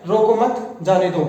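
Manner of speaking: slow "रोको मत", a beat, "जाने दो"; pause after मत. Shown as a demonstration. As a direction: keep मत with रोको, then break. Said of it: "यानी इसे रोकना नहीं है".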